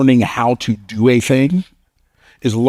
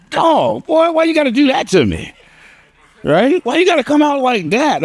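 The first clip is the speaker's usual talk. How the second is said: high, much higher mock disbelief; mock astonished friend. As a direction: play the stunned buddy in a high cartoonish voice. Friend's astonished reaction voiced.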